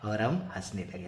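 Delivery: ordinary talk, nothing marked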